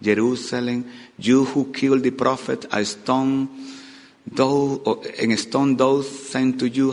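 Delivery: lamentation. Acted. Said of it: "a lamentation".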